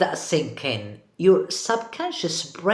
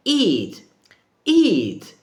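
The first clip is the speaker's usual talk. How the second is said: mock yell, second burst higher and louder; imitated toddler yell; 3 semitones higher, 5 dB louder than usual. Toddler ordering a teddy bear to eat, mock yell. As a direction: bark it like a bossy toddler, half in play. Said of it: "slaps the teddy bear in the face and yells".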